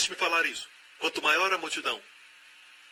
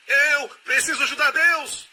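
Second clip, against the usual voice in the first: high, slow, loud frantic shout; a panicking believer who can't wait; shouting in mock desperation; anxious cry; 12 semitones higher, 6 dB louder than usual. Worried believer acted by preacher.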